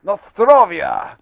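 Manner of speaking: mock Russian cheer; Russian accent. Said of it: "I can do the Russian".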